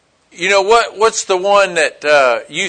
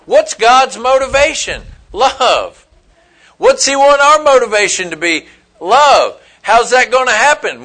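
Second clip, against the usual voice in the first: preaching question and answer; 5 semitones higher, 4 dB louder than usual. Acted. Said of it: "preacher's call and answer".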